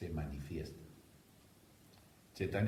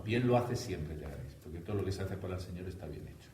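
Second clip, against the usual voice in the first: normal talking voice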